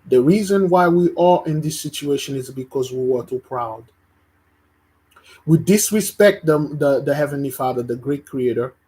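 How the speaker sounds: loud, rising preacher's cry to the congregation; preaching at full force. Real, not acted. Preacher rebuking his congregation, loud and rising. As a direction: thunder it out like a sermon climax.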